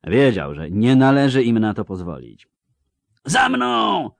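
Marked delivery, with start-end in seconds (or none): 3.2-4.1 very high battle cry; rallying roar. A narrator's reading. Count seizing the banner to lead the charge, roaring. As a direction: yell for the men to follow. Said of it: "ryknął, wyrywając z rąk chorążego sztandar".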